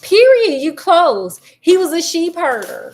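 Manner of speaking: near-shout of excitement, high and loud; excited. Real, not acted. Host thrilled as guest nearly guesses right, high excited exclamation.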